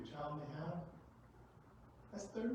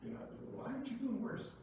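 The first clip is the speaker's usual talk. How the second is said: mock amazement; 11 semitones higher than usual. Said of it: "you say".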